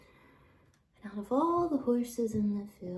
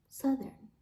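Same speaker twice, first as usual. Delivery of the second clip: ordinary delivery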